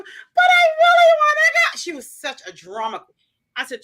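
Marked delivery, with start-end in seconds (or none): 0.0-1.8 put-on high, loud child's wail; a little girl demanding, half shouting; whiny toddler. Toddler whining.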